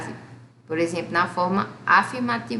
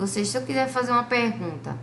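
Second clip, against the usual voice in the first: usual speaking voice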